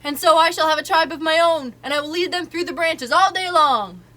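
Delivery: shouting. A narrator's reading. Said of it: "shouting at the top of his voice".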